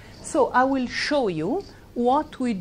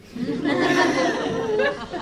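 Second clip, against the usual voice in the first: laugh; 14 semitones higher than usual. Real, not acted. Laughing at her story.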